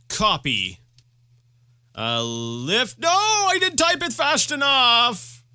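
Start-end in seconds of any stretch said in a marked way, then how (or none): 3.0-5.4 high slow frustrated whine. Real, not acted. Frustrated at game.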